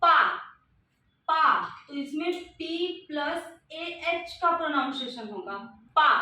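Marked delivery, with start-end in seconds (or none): 0.0-1.6 पा said with a long aa, repeated; sound demo. Shown as a demonstration. Teacher modelling the syllable for the class. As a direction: sound out पा slowly, then say it again. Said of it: "जैसे कि मैं बोलती हूँ".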